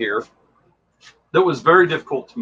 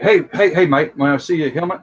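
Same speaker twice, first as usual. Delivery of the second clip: put-on New Zealand accent; 2 semitones higher than usual. Actor asking a fan about a helmet, mock New Zealand accent. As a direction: imitate a friendly New Zealander, badly. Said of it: "that was my terrible New Zealand accent".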